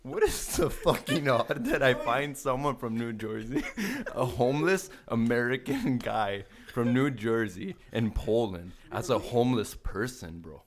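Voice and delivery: incredulous and laughing at once; laughing disbelief. Real, not acted. Host marveling at the coincidence to friends, laughing loudly.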